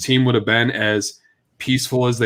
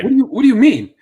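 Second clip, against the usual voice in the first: much higher and louder, disbelieving; incredulous outburst; 11 semitones higher, 6 dB louder, faster than usual. Real, not acted. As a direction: blurt it out in disbelief. Incredulous at the owner's contradiction.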